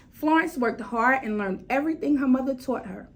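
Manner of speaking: ordinary delivery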